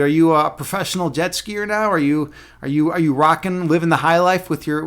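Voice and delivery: ordinary talk, nothing marked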